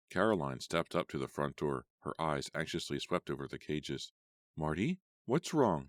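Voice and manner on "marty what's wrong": high, drawn-out questioning; demanding. A narrator's reading. Manager arriving at the shelter, demanding. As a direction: slowly demand an answer, pitch raised. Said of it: "she demanded".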